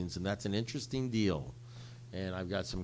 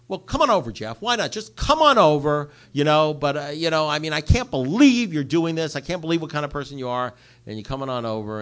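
much higher, much louder scornful tirade; sarcastic angry rant; 6 semitones higher, 13 dB louder than usual. Retold or imitated. Telling off his brother for calling late at night, loud scornful rant. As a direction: let him have it, dripping with contempt.

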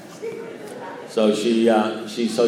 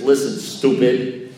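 slow, blunt put-down; mock-endearing insult; 3 dB louder, slower than usual. Sponsor talking down to the man he loves, slow and blunt. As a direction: lay it out slowly for a dimwit. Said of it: "endearing tones".

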